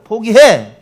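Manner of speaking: loud barked order to quit; shouted taunt. Acted. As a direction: slam the word down. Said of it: "이런 바람이죠".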